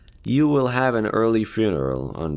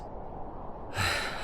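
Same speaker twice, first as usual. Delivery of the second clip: long breathy sigh, relieved; soft sigh of relief; 11 dB quieter than usual. Acted. Companion sighs, glad you're staying, soft relieved sigh. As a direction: let out a quiet relieved sigh. Relieved she won't part ways.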